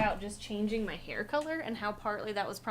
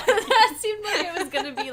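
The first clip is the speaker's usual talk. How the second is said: words through laughter, louder and higher; laughing; 9 semitones higher, 12 dB louder than usual. Real, not acted. Amused at a cryptic message, laughing through words. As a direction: laugh as you try to talk.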